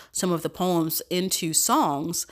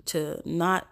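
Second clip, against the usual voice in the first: ordinary talk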